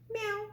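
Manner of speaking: high cat meow; puppy meowing instead of barking; mock meow. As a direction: squeak out a little meow.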